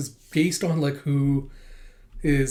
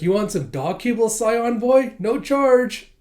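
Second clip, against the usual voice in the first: higher, louder cheeky taunt; teasing offer; 8 semitones higher, 6 dB louder, slower than usual. Acted. Anya taunting Damien with dog kibble, high cheeky offer. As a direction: dangle it in front of him, smugly.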